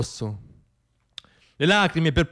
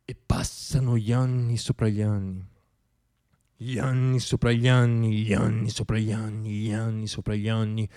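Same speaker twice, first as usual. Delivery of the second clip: softer and lower with each repeat, sinking away; fading repetition; 5 semitones lower, 5 dB quieter than usual. Acted. Poet sinking under the weight of years, fading out.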